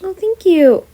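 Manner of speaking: high, bright thanks; flattered. Acted. Wizard thanking the student for the compliment, flattered. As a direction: accept it sweetly.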